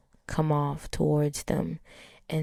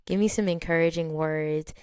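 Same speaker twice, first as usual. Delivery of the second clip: ordinary talk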